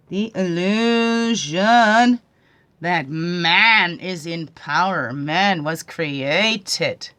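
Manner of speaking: very slow, drawn-out preaching; slow, solemn declaration; slow proclamation. Real, not acted. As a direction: stretch each word like a sermon.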